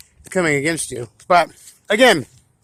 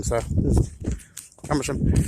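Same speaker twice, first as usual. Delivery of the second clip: chewing gum; 3 semitones lower, 5 dB quieter than usual. Real, not acted. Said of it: "sorry, I'm chewing gum".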